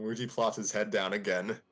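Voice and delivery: ordinary delivery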